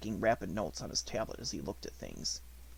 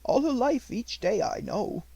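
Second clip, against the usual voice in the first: higher, slow, respectful servant's voice; devoted servant Ramdas; 12 semitones higher, 6 dB louder, slower than usual. A narrator's reading. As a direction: let each word settle, devoted and sure. Ramdas answers.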